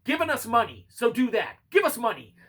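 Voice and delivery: loud, high-pitched playful plug; mock demand. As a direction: shamelessly call out for cash, loud and high. Jokey loud plea for money.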